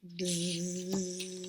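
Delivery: quiet buzzing hum; buzzing bee. Shown as a demonstration. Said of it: "Can you be a busy bee?".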